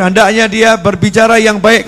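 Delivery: ordinary talk, nothing marked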